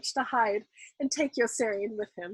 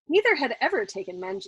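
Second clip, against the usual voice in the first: ordinary delivery, nothing marked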